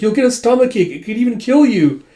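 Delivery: high, loud acted protest; worked-up story heroine; alarmed warning. Girl warns him in alarm.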